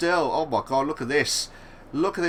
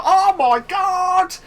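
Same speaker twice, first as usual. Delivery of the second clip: slow, high shouted oh my god; excited shout; 11 semitones higher, 7 dB louder, much slower than usual. Real, not acted. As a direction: blurt it out in shock, loud and high. Crash seen during race commentary.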